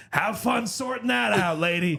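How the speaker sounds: high, slow taunt through laughter; gloating laugh. Retold or imitated. Mocking the customer after dumping junk in her cart, laughing taunt. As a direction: laugh it out as a spiteful parting shot. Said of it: "I was like".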